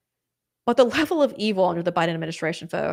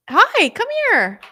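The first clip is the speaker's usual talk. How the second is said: much higher, slow coaxing dog talk; pet voice; 12 semitones higher, 7 dB louder, slower than usual. Real, not acted. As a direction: coo at the puppy to come over.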